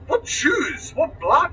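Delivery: high-pitched baffled questions; bewildered protest. Retold or imitated. Storyteller replaying his reaction to the accusation, bewildered. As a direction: pitch swoops up in acted disbelief.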